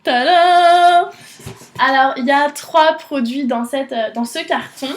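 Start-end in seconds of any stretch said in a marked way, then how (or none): 0.0-1.1 excited reveal. Real, not acted. Unveiling the product.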